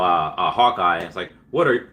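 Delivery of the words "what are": BoJack Horseman impression. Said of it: "he does that BoJack Horseman".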